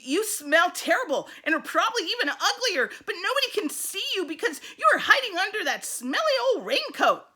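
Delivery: put-on sassy girl. Allison tells off Sammy, loud and quick. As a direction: rattle it off at him, bossy.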